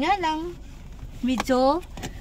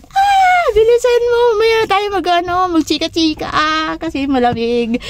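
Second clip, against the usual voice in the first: noisy hurried shout; 7 semitones higher than usual. Retold or imitated. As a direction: yell to hurry up in the cold. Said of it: "ang ingay-ingay pa natin".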